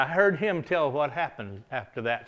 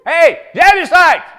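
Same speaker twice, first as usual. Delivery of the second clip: slow, much louder and higher hollering call; 12 semitones higher, 15 dB louder, slower than usual. Acted. Young David hollering up at the Jebusites on the wall. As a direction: call up the hill like a boy shouting at an enemy wall. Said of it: "he's hollering".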